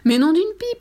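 high, loud sudden exclamation; exclaiming. A narrator's reading. Fifi remembering the introductions, loud surprised exclamation. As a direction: blurt it as if struck by a thought. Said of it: "s'exclama Fifi".

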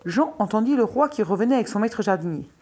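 ordinary delivery

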